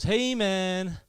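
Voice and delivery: drawn-out friendly hail; friendly American guy. Retold or imitated. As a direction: imitate a chummy American barman. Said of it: "Really friendly guy working".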